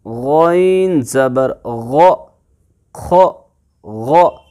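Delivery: गैन ज़बर ग said slowly, then short throaty ख and ग; tajweed letter demo. Shown as a demonstration. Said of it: "ऐसे इनको pronounce करना है".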